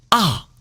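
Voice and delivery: AH falling sharply from high; falling fourth tone. Shown as a demonstration. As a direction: drop "ah" steeply from a high start. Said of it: "And fourth tone falling".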